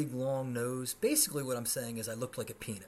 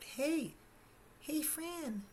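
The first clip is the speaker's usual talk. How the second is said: put-on much higher, girly seventh-grade messenger; schoolgirl voice; slower than usual. Schoolgirl telling Fran a girl likes him, high girly voice. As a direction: chirp it like a young schoolgirl. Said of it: "comes up to me on the schoolyard and says".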